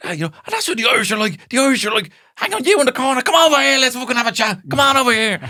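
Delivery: very high, fast, hammed-up brash comic; loud Irish comedian. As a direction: play a brash Irish stand-up calling out the crowd. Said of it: "a comedian do a joke being like".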